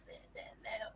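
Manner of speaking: hushed, breathy there there, whispered very softly; soothing whisper. Retold or imitated. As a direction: soothe a crying girl in a whisper. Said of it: "he dabs away those tears and he says".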